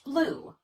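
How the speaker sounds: "Blue" with a high, obvious pitch glide on the vowel; pitch change. Shown as a demonstration. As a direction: stretch "Blue" to show the pitch moving. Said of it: "Listen for that change in pitch".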